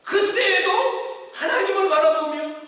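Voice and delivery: loud, high, impassioned preaching; fervent sermon climax, rising and loud; preaching at full force. Acted. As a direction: drive it home to the congregation. Preaching resumes.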